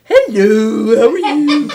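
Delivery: hammed-up, drawn-out cartoon hello; imitated cartoon announcer. Co-host mimics his show-opening greeting, cartoon announcer voice. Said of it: "he does that".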